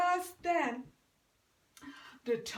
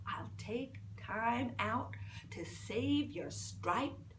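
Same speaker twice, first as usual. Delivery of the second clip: whisper; 3 semitones lower, 7 dB quieter, slower than usual. Acted. Rabbit grudgingly agrees.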